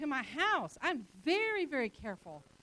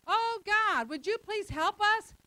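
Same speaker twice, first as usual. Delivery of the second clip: higher, slow, begging plea; desperate plea; 7 semitones higher, 4 dB louder, slower than usual. Retold or imitated. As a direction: beg God to help. People pleading to God.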